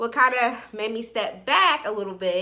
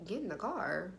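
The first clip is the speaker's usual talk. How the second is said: much softer, questioning repeat; wary echo; 3 semitones lower, 12 dB quieter than usual. Retold or imitated. Woman answering his request to get in, wary. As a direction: echo GET IN THE CAR back quietly as a question.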